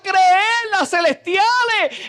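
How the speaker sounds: loud, high preaching at full force; fiery preaching. Acted. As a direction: preach it with fire to the congregation.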